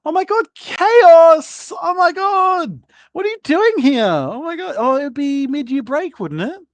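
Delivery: a theatrical aristocrat overjoyed to see a guest; gushing lady. Acted. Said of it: "she's like".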